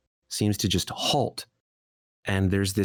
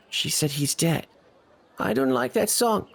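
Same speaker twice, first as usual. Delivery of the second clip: put-on high child voice; little boy; 8 semitones higher than usual. Little boy upset by the sad song, high child's voice. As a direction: protest in a small boy's high voice. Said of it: "The little boy looks up at his mother and says".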